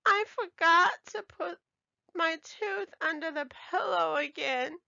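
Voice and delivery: high, tearful wail; little girl upset; crying child. A narrator's reading. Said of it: "cried Ebony".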